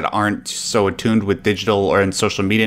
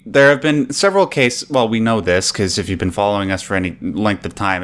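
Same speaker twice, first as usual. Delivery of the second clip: ordinary delivery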